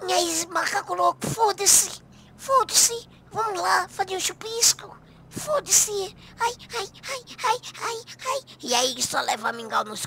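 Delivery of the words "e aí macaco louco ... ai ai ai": high-pitched moaning woman voice; imitating Portuguese women. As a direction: squeal and moan it like a flirty woman. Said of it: "elas falam".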